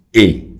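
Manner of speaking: "e" sounded out alone and loud, as French AI; sound demonstration. Shown as a demonstration. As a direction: give "e" as one isolated, emphatic vowel. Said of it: "La A y la I hacen el sonido".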